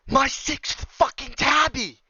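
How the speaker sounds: high, slow, furious yell; furious shout. Real, not acted. Gamer raging at his sixth tabby, furious high yell. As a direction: scream it, at the end of your rope.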